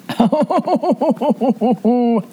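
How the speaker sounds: high wavering "oh-oh-oh" wail; comic warbling howl of dismay; mock wail. Finding her saved leftovers gone, mock wailing.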